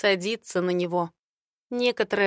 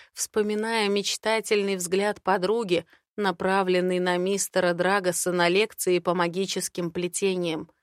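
plain reading voice

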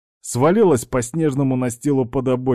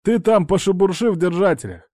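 raised, high voice over the wind; shouting over wind; 8 semitones higher than usual. Acted. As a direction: call it out against the storm. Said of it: "стремился перекричать шум ветра".

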